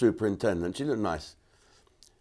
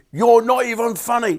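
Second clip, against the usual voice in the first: mock indignant retort; 11 semitones higher, 8 dB louder than usual. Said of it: "He turned on me like that".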